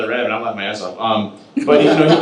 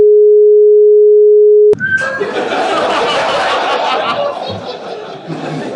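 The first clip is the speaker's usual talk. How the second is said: long, much higher, louder burst of laughter; 5 dB louder than usual. Real, not acted. Remembering how he pranked Chris, loud high laughter. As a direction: cackle hard at your own prank.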